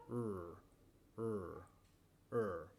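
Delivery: low, held "er" with the tongue off the palate; sonorous English R. Shown as a demonstration. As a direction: sound out "er" slowly as a resonant R. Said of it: "se hace un sonido muy sonoro".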